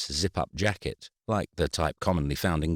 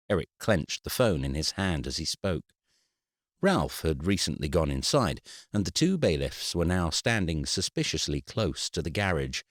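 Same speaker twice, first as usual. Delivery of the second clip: plain reading voice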